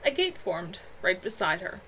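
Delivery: plain reading voice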